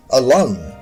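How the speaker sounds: ordinary delivery, nothing marked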